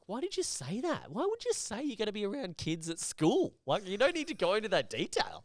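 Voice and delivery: retelling how he scolded the consultant, exasperated; exasperated telling-off. Retold or imitated. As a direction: scold him in disbelief.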